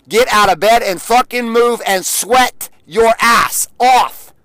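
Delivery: drill-sergeant push to get moving; loud command. Retold or imitated. Ordering her out of bed to sweat, loud slow bark.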